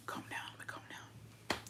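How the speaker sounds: barely audible whisper to oneself; hushed, voiceless self-talk; self-soothing whisper. Real, not acted. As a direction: whisper it to calm yourself down. Whispering to calm themselves down.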